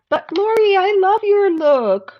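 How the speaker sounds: shower her with affection; gushing. Real, not acted. Gushing to a viewer.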